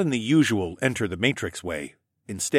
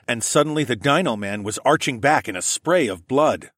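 plain reading voice

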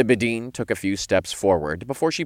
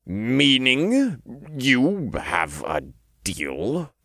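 grudging mutter; 5 semitones higher, much slower than usual. A narrator's reading. Archangel reluctantly offers a deal, muttering. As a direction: drag the words out reluctantly. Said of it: "the Archangel muttered".

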